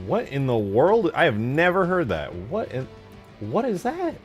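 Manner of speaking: high, loud incredulous questioning; baffled surprise. Real, not acted. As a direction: exclaim it as if utterly stumped. Baffled by unknown item found.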